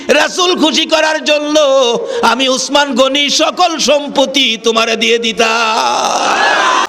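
high, drawn-out preacher's shout; impassioned cry. Acted. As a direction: cry it out high, stretching the phrase ends. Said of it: "একটা চিৎকার মারছে".

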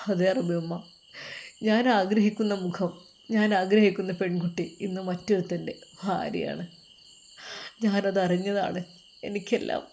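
heartbroken son. Acted. Son revealing his beloved is another's wife, heartbroken.

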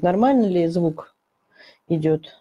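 ordinary delivery

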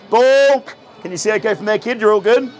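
ordinary talk, nothing marked

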